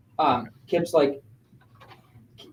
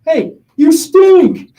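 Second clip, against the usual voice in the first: high, loud, slow drawn-out mock kid taunt; little fan taunting the player, loud high mock kid voice; kid heckling; 12 semitones higher, 12 dB louder, much slower than usual. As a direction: stretch out stink like a cheeky kid heckler. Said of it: "the kid goes".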